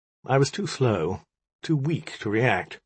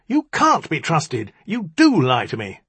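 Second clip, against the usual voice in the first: higher, emphatic accusation; accusing cry; 6 semitones higher, 4 dB louder than usual. A narrator's reading. Magician calls the djinni a liar, crying out. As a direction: hammer the accusation home, pitched up. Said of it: "he cried".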